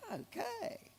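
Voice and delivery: tiny, high whispered reply; awed whisper. Retold or imitated. Speaker to himself after Clinton greets him, awed whisper. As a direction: whisper it, as if lost for words.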